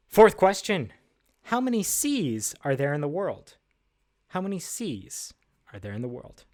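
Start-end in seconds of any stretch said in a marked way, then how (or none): none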